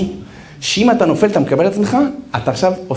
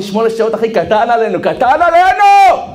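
triumphant shout; 6 semitones higher, 4 dB louder than usual. Retold or imitated. Soldier's triumphant boast.

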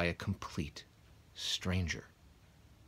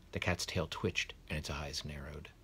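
hushed narration; 4 semitones lower, 6 dB quieter than usual. A narrator's reading. Suspenseful narration.

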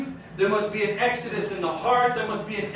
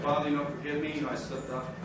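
mumbled half-hearted prayer, much softer and lower; mock a lazy, limp prayer; mock weak prayer; 6 semitones lower, 10 dB quieter, faster than usual. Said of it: "Stop doing that".

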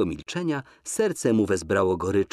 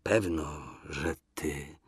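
low, much softer mutter; 5 semitones lower, 9 dB quieter, slower than usual. A narrator's reading. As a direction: mutter it under your breath. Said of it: "mruknął Wasyl".

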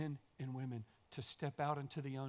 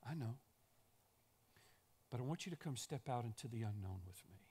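softer, calm Jesus voice; gentle reassurance; 5 semitones lower, 8 dB quieter than usual. Acted. Jesus softly answering the objecting disciples, calm. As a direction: answer them quietly and kindly.

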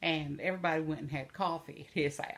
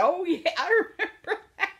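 laughing; 11 semitones higher, 8 dB louder than usual. Real, not acted. Recalling the funny memory, laughing.

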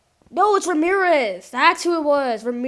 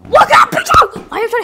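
loud, much higher warning shout; 9 semitones higher, 13 dB louder, faster than usual. Real, not acted. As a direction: yell a warning at the screen. Yelling at the game.

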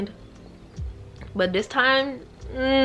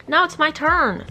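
higher, louder, hyped-up Now it's my turn; excited repeat; 7 semitones higher, 5 dB louder than usual. Shown as a demonstration. Vlogger declaring her hair era to viewers, hyped and loud. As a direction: shout it like a triumphant announcement. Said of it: "Focus on me".

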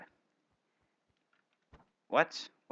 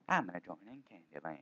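softer, lower repeat of I'm gonna join in Candyland; quiet repeat; 6 semitones lower, 11 dB quieter, faster than usual. Shown as a demonstration. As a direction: mutter it again under your breath. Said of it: "repeats line softly".

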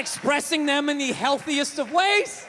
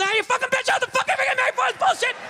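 sudden high mock yell, rushed; dad snapping at the dinner table, yelling; 12 semitones higher, faster than usual. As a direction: explode out of nowhere like the dad. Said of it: "he would just snap".

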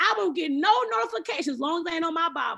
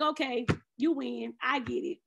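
grudging surrender; 4 semitones lower, 5 dB quieter than usual. Retold or imitated. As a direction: let the pout melt into acceptance. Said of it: "sulk for a minute".

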